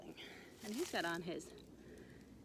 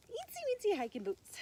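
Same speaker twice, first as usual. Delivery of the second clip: put-on high, squeezed teeny-tiny voice; cutesy tiny; 10 semitones higher, 4 dB louder than usual. A narrator's reading. Storyteller describing a mouse's tiny boots, high cutesy voice.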